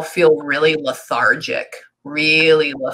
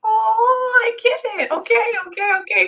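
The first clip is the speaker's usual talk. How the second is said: very high-pitched squeal of understanding; high, emotional "now I get it"; sudden realisation. Real, not acted. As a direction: let it click, voice shooting right up. Sudden realisation about the love child.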